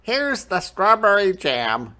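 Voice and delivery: put-on high, loud boy's call; excited Jack shout. A narrator's reading. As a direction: shout it like a proud kid. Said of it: "shouted Jack".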